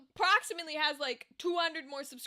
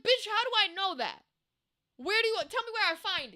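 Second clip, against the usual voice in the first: exasperated; 6 semitones higher than usual. Retold or imitated. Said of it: "I'm like".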